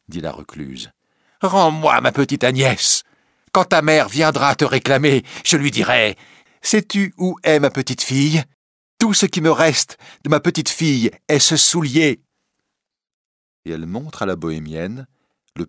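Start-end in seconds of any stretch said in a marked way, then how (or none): 1.4-12.2 loud, high, impassioned lament; anguished demand. Acted. Recluse seeking her little Agnès, anguished. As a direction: demand with desperate maternal grief.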